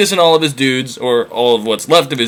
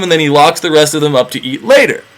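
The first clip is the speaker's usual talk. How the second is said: usual speaking voice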